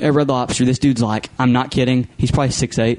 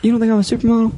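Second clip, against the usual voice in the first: high, fast pleading question; mock needy; 9 semitones higher, faster than usual. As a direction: fish for a compliment. Repeated fishing.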